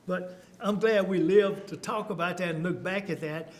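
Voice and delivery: usual speaking voice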